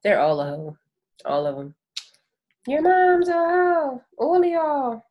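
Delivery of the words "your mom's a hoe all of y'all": exaggerated loud insult. Hammed-up insult at USPS.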